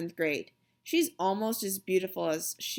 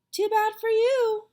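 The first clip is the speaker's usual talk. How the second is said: smug taunt; 13 semitones higher, 6 dB louder than usual. A narrator's reading. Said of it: "Sheila said".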